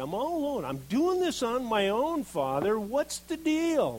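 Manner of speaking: high, loud and pleading, slowing at the end; rising desperate complaint. Acted. Lonely believer crying out to the Father, loud and exasperated. Preacher voices a plea to God.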